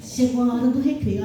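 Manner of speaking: usual speaking voice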